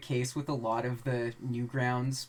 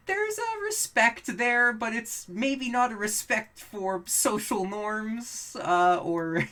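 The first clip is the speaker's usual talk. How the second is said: laughing while talking; 9 semitones higher, 4 dB louder, slower than usual. Real, not acted. Laughing through the point.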